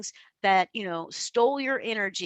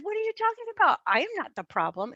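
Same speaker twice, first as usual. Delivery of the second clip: indignant protest; 10 semitones higher, faster than usual. Retold or imitated. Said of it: "indignant, a little offended".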